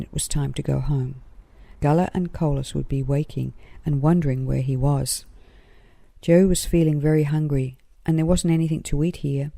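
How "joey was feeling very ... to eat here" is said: usual speaking voice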